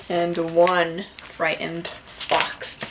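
slow, drawn-out storybook reading with big voices; dramatic read-aloud. Acted. Librarian reading a counting book to children, dramatically. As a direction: savor each number like story time.

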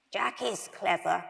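put-on creaky old toy car; croaky voice. A narrator's reading. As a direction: defend him in a lightly croaked voice. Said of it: "said the croaky voice".

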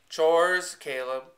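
a mother cutting the talk off, briskly. A narrator's reading. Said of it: "she said briskly".

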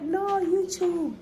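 high and slow, beaming; high, slow fan reply; eager correction. Retold or imitated. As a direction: correct her gleefully, drawn out. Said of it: "And she was like".

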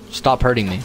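loud yelp under attack; panicking gamer. Real, not acted. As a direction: yell it as you take hits. Being attacked in game.

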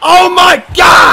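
high, loud, stretched-out exclamation; astonished shout. Real, not acted. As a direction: bellow it in disbelief. Reacts to the match.

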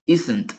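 "isn't" said as /isent/ with a falling tune; pronouncing isn't. Shown as a demonstration. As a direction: model "isn't" like i-sent. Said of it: "se pronuncia". (pronunciar "isn't" como /isent/)

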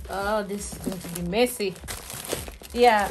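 ordinary talk, nothing marked

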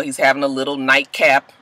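ordinary delivery

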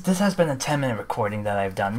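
ordinary talk

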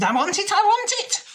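a witch throwing a fit; witch screaming. Acted. The witch screams at Jenny for the box, high and fast. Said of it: "But the witch screamed".

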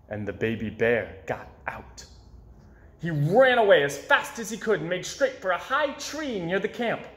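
plain reading voice

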